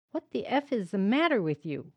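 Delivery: loud, furious demand; angry barrage. A narrator's reading. Father berating his son for the open door, loud fury. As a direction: tear into the boy. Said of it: "his barrage of insults".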